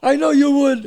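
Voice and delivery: retold giddy retort. Acting himself laughing back at father.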